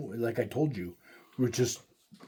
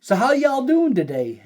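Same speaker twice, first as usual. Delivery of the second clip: Texas clerk; 8 semitones higher than usual. Retold or imitated. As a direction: mimic the Texas store clerk. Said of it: "she says".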